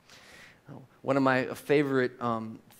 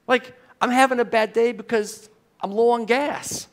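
higher, louder put-on complainer voice; mock petty whiner; 7 semitones higher, 7 dB louder than usual. Preacher mocking a petty complaint, hammed-up whiny voice. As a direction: lampoon a spoiled churchgoer's gripe.

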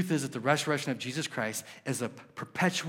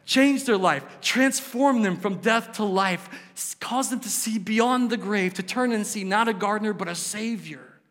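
interceding prayer; 6 semitones higher, 5 dB louder than usual. Retold or imitated. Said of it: "he's saying".

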